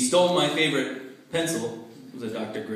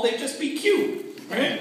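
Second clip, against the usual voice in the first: cutesy coo; 11 semitones higher, slower than usual. Acted. Cooing at tiny terrorists.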